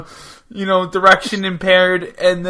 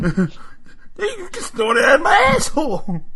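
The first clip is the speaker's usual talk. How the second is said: laughing through voice; 9 semitones higher than usual. Acted. Voicing [name] in a jail bit.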